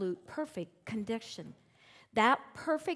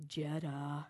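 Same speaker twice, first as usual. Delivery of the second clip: Jetta; 6 semitones lower, 6 dB quieter than usual. Retold or imitated. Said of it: "in this low voice of hers".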